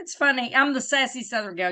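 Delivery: normal talking voice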